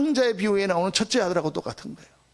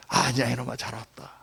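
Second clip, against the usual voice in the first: tender father; 8 semitones lower, 3 dB quieter than usual. Acted. Father welcomes prodigal son.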